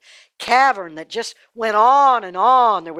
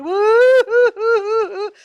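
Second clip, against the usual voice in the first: high, loud mock wail; hammed-up mourners' hooting cry, long and drawn out; funeral wailing; 12 semitones higher, 6 dB louder, slower than usual. A narrator's reading. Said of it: "everybody came along behind and went".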